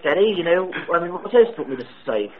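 a prank caller faking British; put-on British accent. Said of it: "sounded like someone putting on an accent".

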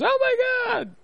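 very high falsetto wife voice; imitated shocked wife. Husband imitating his wife looking down, shocked high squeal. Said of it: "and she goes".

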